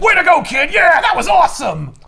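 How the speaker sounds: high, loud cheering; hammed-up whoop of praise; mock cheering Batman. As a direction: cheer the kid on wildly. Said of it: "And Batman's like".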